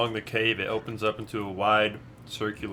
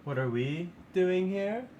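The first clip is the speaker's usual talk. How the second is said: higher, otherworldly forest spirit; eerie dryad voice; 8 semitones higher, 3 dB quieter, slower than usual. Acted. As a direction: answer like an amused dryad. DM plays dryad NPC.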